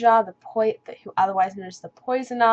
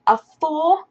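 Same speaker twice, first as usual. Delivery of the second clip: "A four?" rising high, incredulous; disbelieving question; surprised; 6 semitones higher, 5 dB louder than usual. Retold or imitated. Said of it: "And she's like".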